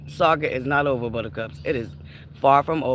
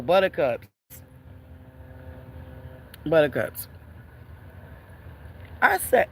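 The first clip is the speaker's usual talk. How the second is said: usual speaking voice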